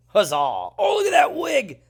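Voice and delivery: a loud, high mock-colonial cheer; excited cheer. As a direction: shout it like a delighted colonist.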